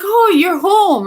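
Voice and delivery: high, slow, delighted "oh, you're home"; happy surprised greeting. Retold or imitated. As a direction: greet her gladly, stretching it out. Greeting aunt in a dream.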